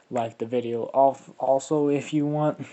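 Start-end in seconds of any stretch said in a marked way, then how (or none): none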